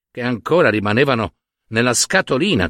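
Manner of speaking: plain reading voice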